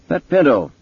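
high, loud character voice; detective's demand. A narrator's reading. Andy Mason to Townsend over coffee, high and forceful.

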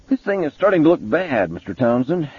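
high-pitched character voice; ominous detective. A narrator's reading. Mason warning Townsend the case looks bad, high-pitched.

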